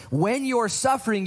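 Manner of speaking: slow, lifted "When you're suffering" stretched out; slow emphasis. Acted. Preacher presses the point to the congregation, slow and high. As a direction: lean into "suffering" slowly and high. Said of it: "sermon emphasis".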